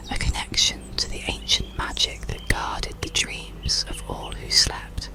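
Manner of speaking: usual speaking voice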